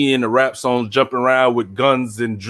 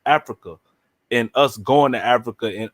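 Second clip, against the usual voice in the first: usual speaking voice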